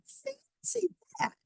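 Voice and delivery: put-on very high, slow little old lady marvelling. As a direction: squeak it like a tiny amazed old woman. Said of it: "the little old lady".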